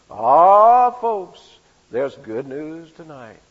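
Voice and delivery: put-on old-time radio newsman announcing good news; Gabriel Heater radio intonation. Preacher imitating a radio newsman to the congregation. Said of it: "He'd say with that intonation".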